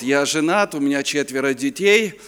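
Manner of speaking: ordinary delivery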